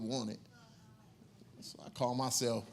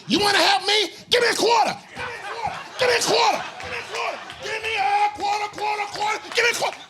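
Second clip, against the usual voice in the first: much higher and louder frantic chant; screaming demand; 9 dB louder than usual. Retold or imitated. Blade screaming at the comedian after kicking away the food. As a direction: scream for a quarter over and over. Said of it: "He's screaming".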